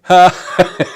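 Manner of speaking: a sudden loud burst of laughter. Real, not acted. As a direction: let out a big real laugh at your own joke. Laughs at own joke about Jill.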